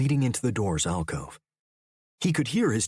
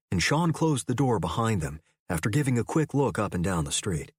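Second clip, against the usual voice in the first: ordinary delivery, nothing marked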